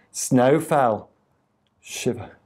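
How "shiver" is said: soft, shivery near-whisper; quiet storytelling effect; hushed shiver. A narrator's reading. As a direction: drop right down, as if chilled. Acting out the falling snow's chill.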